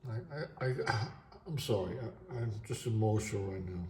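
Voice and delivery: soft, choked-up apology with a sigh; fighting back tears while apologizing; real emotion, holding back tears. Real, not acted. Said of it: "I'm gonna cry".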